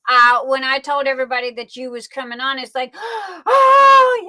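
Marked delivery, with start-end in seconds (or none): 2.9-4.2 a high, loud, breathless oh of shock; starstruck gasp. Retold or imitated. Host acting out her shock at the guest's visit, high and loud. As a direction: play the fan who just heard the star is coming.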